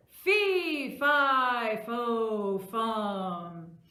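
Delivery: very loud, drawn-out chant stepping down in pitch; booming giant. Acted. Giant sniffing out Jack in a children's tale, booming. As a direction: bellow each word long as a giant. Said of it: "And the giant said".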